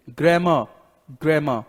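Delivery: "ग्रामर" said as "grae-ma", final r silent; correct pronunciation. Shown as a demonstration. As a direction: drop the last r of "ग्रामर": "grae-ma". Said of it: "इसको क्या pronounce करते हैं".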